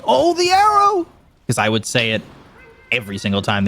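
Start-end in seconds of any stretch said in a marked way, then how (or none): 0.1-1.1 very high mock-excited exclamation; imitated hype call. Caster quoting his sampled catchphrase, mock excitement. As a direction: replay your own over-hyped catchphrase, pitched way up. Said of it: "It was just me going".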